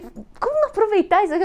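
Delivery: high, quick and bubbly; excited. Retold or imitated. Answering the grumpy man, thrilled. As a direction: gush like an excited kid. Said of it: "Eu toda empolgada".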